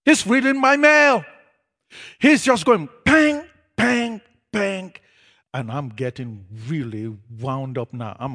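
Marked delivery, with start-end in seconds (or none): 3.0-4.9 imitated rapid shots. Pastor firing off my secrets.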